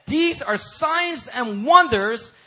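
loud, high, slow preaching at full force; climactic preaching. Real, not acted. Preacher summing up Jesus' miracles for the congregation, climactic preaching. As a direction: stretch each phrase out into a ringing climax.

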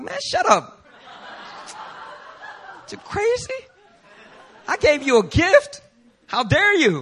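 very high, squeaky hammed-up brush-off; mock dismissive retort. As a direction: toss it back at him, laughing. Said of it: "I'd be like".

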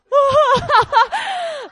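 high loud laugh; giggling fit; burst of laughter. Real, not acted. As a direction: crack up at the memory. Laughing at family drama.

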